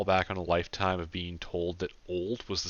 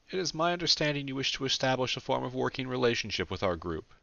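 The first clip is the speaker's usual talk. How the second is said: formal character voice, pitched high; studied calm in a raised voice; effortful calm; 7 semitones higher than usual. A narrator's reading. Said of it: "Calmly, without sighing or expressing anything".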